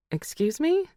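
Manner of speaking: a high, shocked excuse me; startled. Acted. Daughter caught out by mom's hint about a man, startled. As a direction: pitch shoots up in disbelief.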